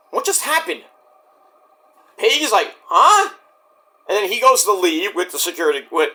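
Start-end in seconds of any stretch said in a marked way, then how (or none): 0.1-0.8 tag. Retold or imitated. Acting own baffled reaction. 2.8-3.3 high questioning mock grunt; imitated confused yelp; baffled reaction. Acting Page's confusion.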